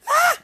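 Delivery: short, very high, loud squeal; high shriek. Real, not acted. Vlogger who just named her cousin on live, shrieking. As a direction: let out one high, loud yelp.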